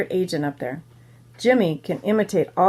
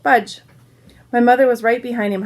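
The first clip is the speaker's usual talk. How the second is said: usual speaking voice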